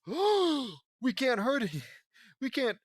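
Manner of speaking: high, loud, drawn-out dramatic cry; slow theatrical yell at high pitch; urgent shout. Acted. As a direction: shout a desperate warning. Hero's urgent warning to friends.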